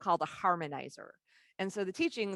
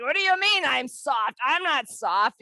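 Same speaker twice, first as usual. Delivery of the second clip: higher, louder offended protest; indignant retort; 7 semitones higher, 10 dB louder than usual. Retold or imitated. Wanting to punch the woman, she protests indignantly. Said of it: "wanted to kind of punch her in the face and be like".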